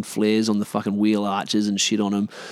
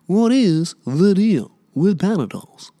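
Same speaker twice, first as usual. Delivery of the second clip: higher, slower stand-up bit voice; Jerry Seinfeld impression; 9 semitones higher, 4 dB louder, slower than usual. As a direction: do the Seinfeld "what's the deal" shtick. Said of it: "I sound like fucking Jerry Seinfeld".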